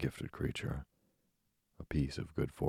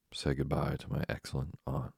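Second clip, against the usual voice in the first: usual speaking voice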